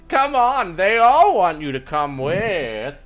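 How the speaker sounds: high, loud, drawn-out coaxing; coaxing puppet hero. Acted. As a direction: plead theatrically, stretching the words. Hero puppet coaxes the elf.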